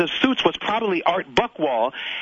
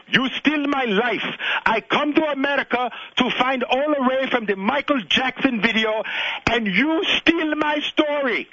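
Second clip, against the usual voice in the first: put-on thick African accent; 9 semitones higher than usual. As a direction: play the accuser with an African accent. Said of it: "with a thick African accent".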